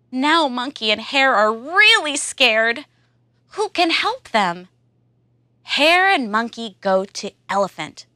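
usual speaking voice

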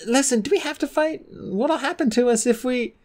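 high pleading dragonet voice, cut off mid-question; young dragon pleading. A narrator's reading. Clay pleading with Fjord in the arena. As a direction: beg him not to fight, voice high.

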